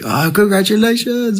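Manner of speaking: high, slow, gushing well-wisher; gushing congratulations. Retold or imitated. Restaurant diners congratulating the newly engaged couple, high gushing coo. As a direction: coo it up high like a delighted stranger. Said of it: "everyone comes up to us and is like".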